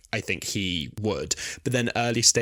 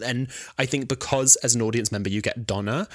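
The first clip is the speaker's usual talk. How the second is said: ordinary talk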